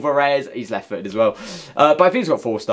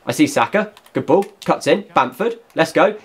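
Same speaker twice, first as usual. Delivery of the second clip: ordinary talk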